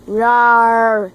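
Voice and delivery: loud playful bear roar. Pretend. Playing the giant bear.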